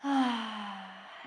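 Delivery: audible open-mouthed exhale; relaxing sigh. Real, not acted. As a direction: let out a big settling sigh. Getting comfortable, breathing out.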